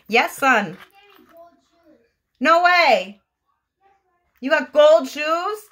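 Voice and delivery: excited exclaiming. Real, not acted. Mother cheering her little one's Sonic game, excited and amazed. Calls back to her gaming son.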